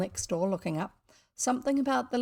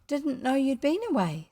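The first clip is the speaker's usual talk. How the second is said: put-on higher child's voice; little girl; 5 semitones higher than usual. Joan replying to boy next door, girlish. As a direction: answer like a puzzled little girl.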